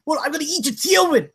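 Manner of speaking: high, lisping threats; Mike Tyson voice. Acted. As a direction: pitch it up and lisp like Tyson. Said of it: "try to get back to my Mike Tyson voice".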